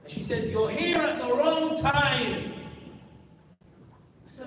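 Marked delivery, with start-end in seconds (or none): 0.5-2.5 retold shouting. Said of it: "why you're shouting at me".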